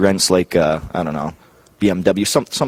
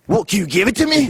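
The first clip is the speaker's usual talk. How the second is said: very high put-on voice; mock Scotty. Scotty challenging his partner back, very high put-on voice. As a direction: toss the question back.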